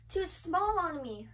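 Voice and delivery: ordinary talk, nothing marked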